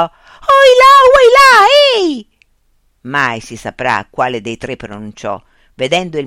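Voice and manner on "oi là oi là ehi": very high, full-force holler; startled high yell; screamed cry. A narrator's reading. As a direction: scream it out, high and piercingly loud. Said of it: "anche lei gridò".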